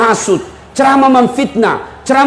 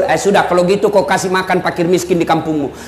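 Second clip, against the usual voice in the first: lowered Prophet voice; 7 semitones lower, 3 dB quieter than usual. Retold or imitated. Prophet answers the man.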